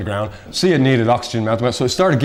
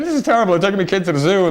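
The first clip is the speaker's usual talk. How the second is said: mock outraged mother; 8 semitones higher, faster than usual.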